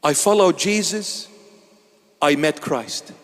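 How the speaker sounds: ordinary delivery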